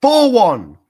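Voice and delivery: a yelped cry of disbelief; incredulous shout. Real, not acted. Streamer yelling the scoreline back at the clip, incredulous. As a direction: shout it high and loud in disbelief.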